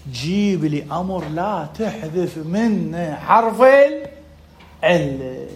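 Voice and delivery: very slow, spaced-out words; slow, deliberate. Real, not acted. Teacher restates the question for the student, slow and deliberate. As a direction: spell out the question word by word.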